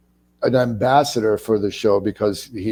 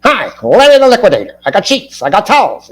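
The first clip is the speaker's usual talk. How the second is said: hammed-up high, loud salesman pitch; Larry the Liquidator; 10 semitones higher, 7 dB louder, faster than usual. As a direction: rattle it off like a cheesy discount huckster. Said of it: "this is what I think about".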